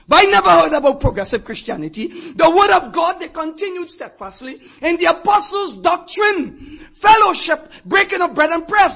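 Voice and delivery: loud, rising pulpit preaching; full-force preaching. Acted. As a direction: thunder it out to the congregation.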